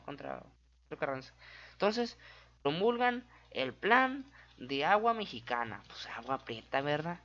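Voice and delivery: usual speaking voice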